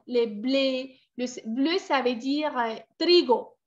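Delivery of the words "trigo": usual speaking voice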